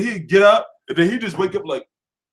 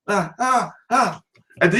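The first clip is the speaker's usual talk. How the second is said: usual speaking voice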